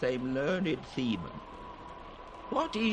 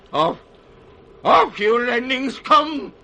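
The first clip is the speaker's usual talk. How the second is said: raving, louder and higher cry; frenzied shout; 8 semitones higher, 11 dB louder than usual. Acted. Lear raving at the storm, shouting wildly. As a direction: tear the words out in madness. Lear tearing off his clothes.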